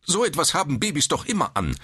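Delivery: high, brisk, starchy voice; prim nurse. A narrator's reading. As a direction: answer primly and briskly. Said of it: "sagte die Schwester spröde". (spröde Krankenschwester)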